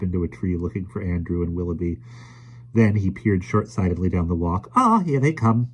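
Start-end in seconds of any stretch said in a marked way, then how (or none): none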